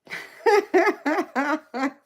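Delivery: high, loud burst of laughter; real, loud high-pitched giggling fit; hearty laugh. Real, not acted. Amused at chat comment.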